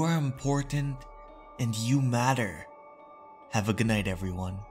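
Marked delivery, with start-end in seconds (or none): none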